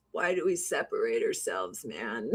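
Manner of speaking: low, soft stoner musing; super stoned. Retold or imitated. Recalling being super stoned on the couch, dazed musing. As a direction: imitate a stoned philosopher, low and quiet. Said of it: "I was just sitting there super stoned".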